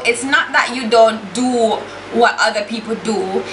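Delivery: ordinary delivery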